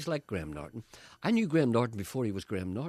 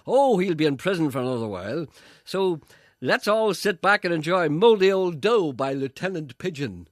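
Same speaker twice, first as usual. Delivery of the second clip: loud, slow breezy radio patter; mock presenter; 7 semitones higher, 10 dB louder than usual. Co-host [name] announcing a record, hammed-up, loud. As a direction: announce it like a cheesy DJ. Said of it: "[name] says".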